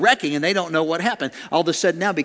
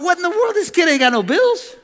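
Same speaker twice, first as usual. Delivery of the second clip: high, loud comic rant; mock outrage; 12 semitones higher, 5 dB louder than usual. Adult pinched by a pastor's kid he feeds, mock outrage. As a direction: rant indignantly, pitched way up. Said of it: "But I thought".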